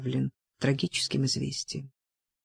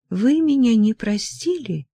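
higher, plaintive question; sad question; 6 semitones higher than usual. A narrator's reading. Daughter asks whether she is forgiven, sadly. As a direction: ask it sadly, pitch lifted. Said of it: "спросила она печально".

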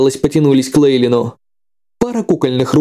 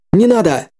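shout; 6 semitones higher than usual. Acted. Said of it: "внезапно крикнул ему".

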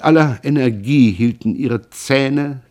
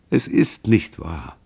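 tender comfort; 5 semitones lower, slower than usual. A narrator's reading. Son comforts weeping mother.

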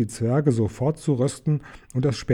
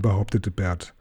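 usual speaking voice

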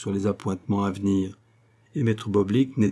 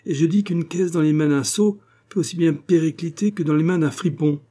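high-pitched scathing lecture; brusque and contemptuous; curt, cutting; 6 semitones higher, 4 dB louder than usual. A narrator's reading. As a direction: press the insult home. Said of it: "d'un ton cassant".

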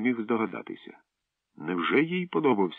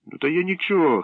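higher, louder sly backpedal; sly boy smoothing things over; sly grin; 10 semitones higher, 6 dB louder than usual. Acted. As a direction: grin it slyly. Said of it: "хитренько всміхнувся Прій".

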